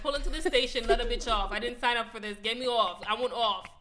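loud, comic pleading to be let out; panicked demand. Retold or imitated. Scared rider begging to get off the train, loud mock panic.

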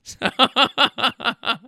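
hearty laughter at the memory; real laugh. Real, not acted. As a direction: open laugh, trailing off. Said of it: "cracked myself up".

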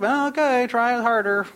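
high, singsong brush-off; mock parental reply. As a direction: answer the whining child in mock singsong. Parent answering the sick daughter.

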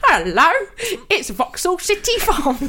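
Cockney farmer. Pretend. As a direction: announce the city farm like a Cockney farmer. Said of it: "Cockney farmer".

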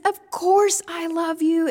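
fed up at being asked again and again; exasperated. Acted. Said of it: "now Peter must be exasperated".

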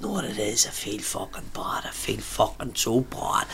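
put-on slow, whispered drunken confession; whispering. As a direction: whisper it heavily, dragging the words. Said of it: "what are you whispering for".

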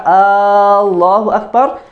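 "Allahu Akbar" drawn out slowly with a long opening a; elongated wrong takbir; incorrect takbir. Shown as a demonstration. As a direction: drag the start of "Allahu" like a question. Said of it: "that's incorrect".

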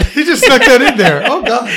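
high, helpless laughing voice. Real, not acted. Co-host cracking up over what got snuck in, laughing.

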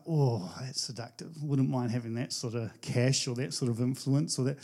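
low, soft, hushed voice of temptation; tempted murmur. Retold or imitated. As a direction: murmur it like the lure is pulling you in. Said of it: "It's like".

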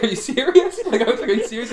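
high, laughing disbelief; mock incredulous. Patient retelling his reply to the scanner operator, laughing incredulity. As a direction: question it in a high, amused voice.

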